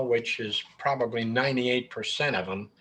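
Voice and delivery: ordinary talk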